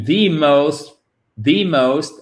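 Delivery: "the" said as a long "thee" before most; stressed "thee" in "the most"; emphatic THE. Shown as a demonstration. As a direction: lean on THE, said "thee". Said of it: "insister en disant the".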